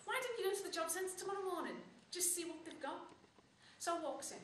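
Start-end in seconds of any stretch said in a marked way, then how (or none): 0.1-3.0 lightly voiced mum, higher pitch; a hint of a mum's voice. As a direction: mum making a suggestion, pitched up. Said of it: "So my mum goes to me".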